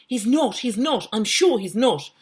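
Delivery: high, eager protest; high-pitched child crying out excitedly. Acted. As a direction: insist it eagerly in a high girl's voice. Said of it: "cried Janet".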